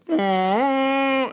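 high held note like a horn blast; high hum imitating a horn. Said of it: "the guy will go".

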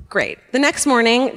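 ordinary talk, nothing marked

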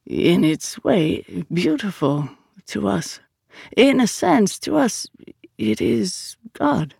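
lilting, slowing revelation with measured pauses; Vito, reverent mystic. Acted.

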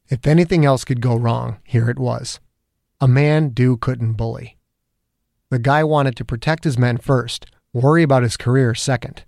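ordinary delivery

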